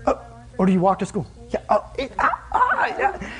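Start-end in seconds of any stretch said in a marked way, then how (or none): 1.7-3.3 laughing. Real, not acted. Laughing at the odd question.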